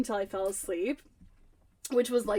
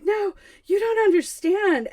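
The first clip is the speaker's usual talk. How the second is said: higher, louder protest; exasperated retold plea; 8 semitones higher, 6 dB louder than usual. Shaken storyteller protesting to husband, exasperated. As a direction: insist they just don't get it.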